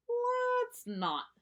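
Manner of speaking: a fed-up little cub cutting it off; weary refusal. Acted. Sister flatly refuses party.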